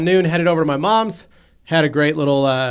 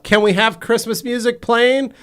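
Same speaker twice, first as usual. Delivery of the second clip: mock kid call; 7 semitones higher, 3 dB louder than usual. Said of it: "Hadley yells out".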